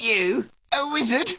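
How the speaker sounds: high-pitched disbelief; incredulous scoff. Acted. Wizard hearing the boy's ambition, high incredulous scoff. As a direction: pitch climbing in mocking amazement.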